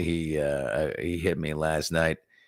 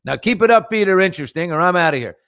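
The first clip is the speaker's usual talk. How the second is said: mock warning; 9 semitones higher, 8 dB louder than usual. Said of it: "I thought she was telling me".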